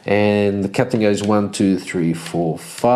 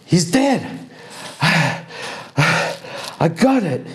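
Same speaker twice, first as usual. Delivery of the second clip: high relieved archer; 6 semitones higher, much slower than usual. Acted. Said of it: "she calls out to him".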